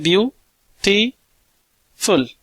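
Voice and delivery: Beautiful stretched very slowly as bi-u-ti-ful; drawn-out syllable count of beautiful; broken into syllables. Shown as a demonstration. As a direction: break beautiful into separate slow beats. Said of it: "हम यहाँ पे break कर रहे हैं".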